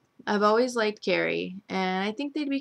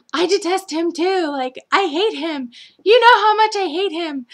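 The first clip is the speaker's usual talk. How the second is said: high, loud mocking whine; mocking Nicole; 11 semitones higher, 8 dB louder than usual. Nicole hating EJ, mocked high and loud. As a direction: whine it out, much higher and louder, sending her up. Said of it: "And she's like".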